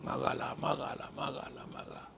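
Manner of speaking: soft, coaxing whisper of a tempter; quiet, luring chant of marahlah; tempting voice. Pretend. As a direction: murmur it like a tempter urging you on. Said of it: "Tak ada malaikat kata".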